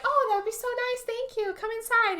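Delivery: high, sugary welcome; sweet neighbor lady. Retold or imitated. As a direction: greet her like an overly friendly neighbor. Neighbor lady voiced welcoming Hannah.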